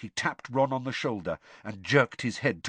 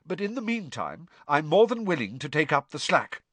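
puffed-up, raised voice; self-important Percy; 8 semitones higher, 4 dB louder than usual. Acted. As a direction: sound important. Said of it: "he laughed airily".

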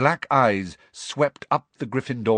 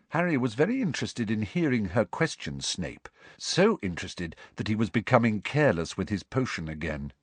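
ordinary delivery

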